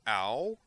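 sudden loud pained ow. Acted. Griffin hit with a pistol butt, pained ow. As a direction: cry out as the pistol hits.

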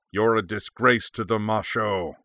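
angry bellow; roar. A narrator's reading. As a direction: roar it in disgust at a comrade. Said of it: "Anubishu roared".

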